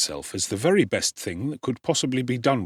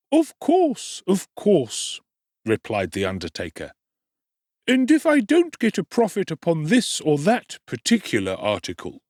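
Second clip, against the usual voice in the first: undertaker's voice; 10 semitones higher, 4 dB louder than usual. A narrator's reading. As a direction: plead your trade's costs in a high oily voice.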